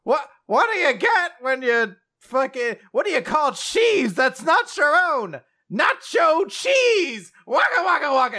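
high, loud, hammy puppet voice, yelled punchline; squeaky dummy shouting a cheesy pun; goofy ventriloquist puppet. Retold or imitated. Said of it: "like, a goofy Vince Williquist puppet?".

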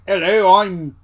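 hammed-up Cockney film hero; mock Cockney. Podcaster imitates a film character to the co-host, mock Cockney. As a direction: do a bad Cockney accent. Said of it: "He's not Cockney".

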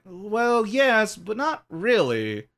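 high, drawn-out reluctant concession; mock hedging. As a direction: waver upward, then slowly take it back. Mock reluctant concession to simple reading.

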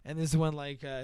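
ordinary talk, nothing marked

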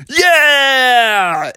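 high, long drawn-out cheer; cheering kids. A narrator's reading. Kids offered ice cream, long high cheer. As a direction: stretch one gleeful word up high.

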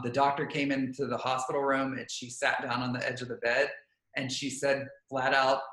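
ordinary talk

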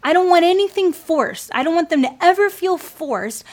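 want and ever pushed up high and loud; stressed plea. Retold or imitated. A mom begging the Lord in prayer, pleading. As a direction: press the plea hard on want. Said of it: "emphatic prayer peak".

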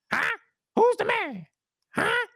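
squeaky voice. Retold or imitated. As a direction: squeak it like a cocky wrestler. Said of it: "squeaky voice with it".

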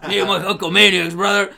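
loud wrestler promo voice; Hulkster. Acted. Imitating the champion hailing his fans, loud hammed-up wrestler voice. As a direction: belt it out as a boastful wrestler, half laughing. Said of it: "he's the Hulkster".

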